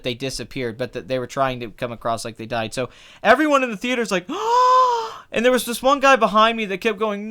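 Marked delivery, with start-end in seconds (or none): 4.3-5.2 big high-pitched gasp; mock shocked gasp. Imitating everyone in the theater, shocked gasp. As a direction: gasp like a whole shocked audience.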